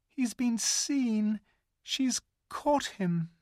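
put-on high, slow, fearful girl voice; a little girl dreading the worst; Lucy. A narrator's reading. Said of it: "thought Lucy".

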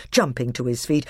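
plain reading voice

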